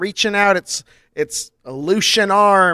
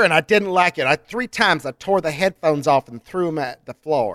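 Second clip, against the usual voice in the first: ordinary talk, nothing marked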